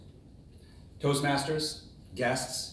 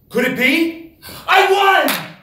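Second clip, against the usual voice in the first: rising then much higher, louder shout of victory; triumphant cry; 14 semitones higher, 10 dB louder, slower than usual. Real, not acted. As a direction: explode with joy on the win. Winning the last putt.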